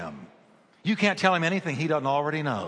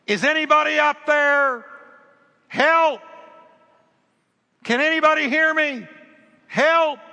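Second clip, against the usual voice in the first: high, loud acted yell; yelling for help; 11 semitones higher, 7 dB louder than usual. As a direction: call up the cliff for rescue. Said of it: "And he starts yelling".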